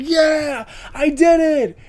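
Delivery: high, bright victory yell; mock triumphant cheer. Quarterback scoring a touchdown, mock triumphant cheer. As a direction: celebrate scoring like a happy kid. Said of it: "he's like".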